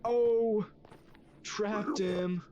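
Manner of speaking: 高声得意欢呼; triumphant shout. Real, not acted. Traps mob, triumphant.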